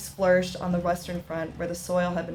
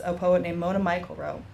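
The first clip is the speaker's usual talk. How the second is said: normal talking voice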